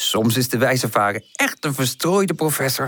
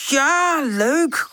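put-on high, cheering children's cry; enthusiastic. A narrator's reading. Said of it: "roepen de tulpjes enthousiast". (hoog, enthousiast juichen)

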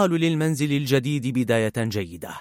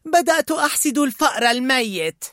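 Disgust; 11 semitones higher, 4 dB louder than usual. A narrator's reading. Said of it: "قالت اشمئزاز".

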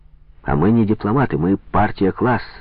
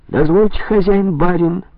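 mock servant; 10 semitones higher, 3 dB louder than usual. A narrator's reading. Lenin mocks as a servant.

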